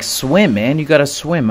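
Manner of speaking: urgent urging. Retold or imitated. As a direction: push him on, mildly frantic. Friend urging him to swim.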